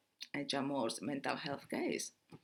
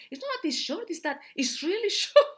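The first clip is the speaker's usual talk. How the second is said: laughing while quoting; 12 semitones higher, 8 dB louder than usual. Retold or imitated. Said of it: "And then I said".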